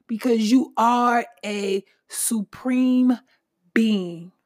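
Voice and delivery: usual speaking voice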